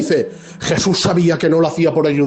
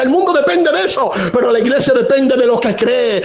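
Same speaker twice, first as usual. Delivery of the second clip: raised, driving sermon voice, building conviction; fiery preaching; 5 semitones higher than usual. Acted. Pastor exhorting the church on tears and hope, fervent preaching. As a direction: preach it out to the whole congregation. Preacher rising to full force.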